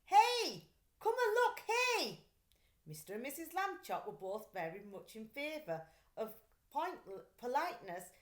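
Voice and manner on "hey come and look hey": put-on high, loud boyish call from the bedroom. A narrator's reading. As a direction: shout it out to bring the parents running. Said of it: "called from the bedroom".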